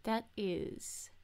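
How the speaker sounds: slow, soft, self-satisfied acceptance; hushed, measured smugness; smug. Shown as a demonstration. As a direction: grant forgiveness smugly and quietly. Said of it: "That was smug, wasn't it?".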